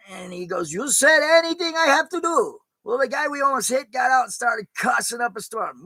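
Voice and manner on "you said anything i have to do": high, put-on cabbie voice; cabbie retort. Cabbie reminding his passenger of the order, high put-on voice. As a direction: toss it back like a cocky cabbie.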